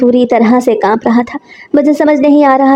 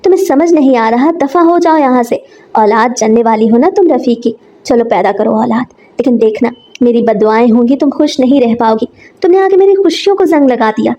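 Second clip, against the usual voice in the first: plain reading voice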